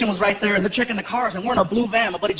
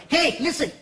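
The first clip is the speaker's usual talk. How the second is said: lightly voiced fellow comic, pitched higher; a hint of a higher Chicano-comic voice; Paul Rodriguez; 4 semitones higher than usual. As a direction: talk like a keen pal phoning with plans. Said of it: "He calls up the comic strip".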